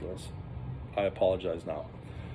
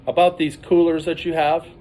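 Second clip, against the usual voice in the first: stern trooper; 7 semitones higher, 8 dB louder than usual. Retold or imitated. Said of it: "He goes".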